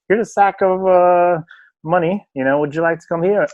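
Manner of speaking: high-pitched put-on recruiter voice; mock tempting offer. Voicing a club tempting a player.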